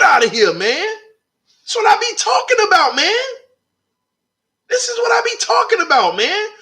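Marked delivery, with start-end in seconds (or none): 1.6-3.2 high, excited shout of joy; hyped up. Real, not acted. Hyped reaction to Drake play. 4.7-6.5 higher still, fast and thrilled; even more hyped. Real, not acted. Hyped reaction, repeated.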